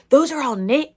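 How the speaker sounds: loud, high amazed exclaim. Retold or imitated. Kids seeing the knitted cover, loud and amazed. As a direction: gasp it out like the kids did.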